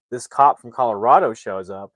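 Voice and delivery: ordinary delivery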